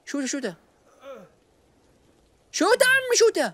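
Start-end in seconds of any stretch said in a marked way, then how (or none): 2.5-3.5 high, eager cheer to finish him; excited urging. Real, not acted. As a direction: egg it on, pitched up.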